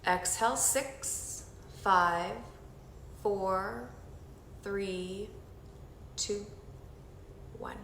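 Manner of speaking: soft, slow counting through each breath; guided breathing count. Acted. Instructor leading the group through breathing, soft slow count. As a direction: guide them through the breath, gently and slowly.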